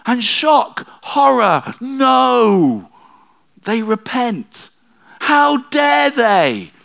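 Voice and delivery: high, loud mock horror, slowing to the end; hammed-up gasp of outrage, drawn out; mock shock. Preacher mocking Jonah's outrage that Nineveh repented, hammed-up horror.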